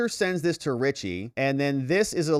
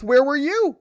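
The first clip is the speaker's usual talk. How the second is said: sharp high demand, loud and pitched up; demanding shout; 13 semitones higher, 8 dB louder than usual. Acted. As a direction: throw the question at him hard. Voicing worried partner's texts.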